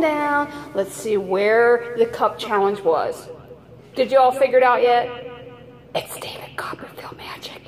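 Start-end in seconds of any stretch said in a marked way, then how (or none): 5.9-7.6 hushed magician's aside; secretive stage whisper. Pretend. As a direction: whisper it very softly. Mock-mysterious aside about magic.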